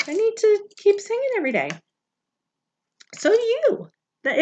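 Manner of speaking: ordinary delivery, nothing marked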